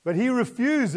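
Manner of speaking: normal talking voice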